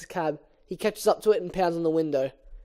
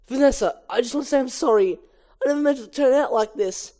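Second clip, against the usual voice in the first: hurried apology; 5 semitones higher than usual. Acted.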